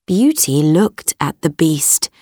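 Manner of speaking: usual speaking voice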